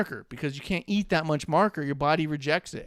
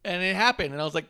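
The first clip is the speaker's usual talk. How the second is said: ordinary talk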